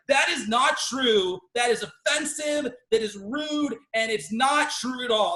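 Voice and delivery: slow, loud indignant objection; mad. Retold or imitated. Friend Ray objects to the camp slogan, acted mad. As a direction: hammer each charge in offended anger. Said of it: "why are you so mad?".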